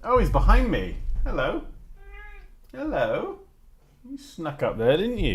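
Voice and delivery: high cooing voice for a pet; cooing to the cat. Real, not acted. Talking to the cat.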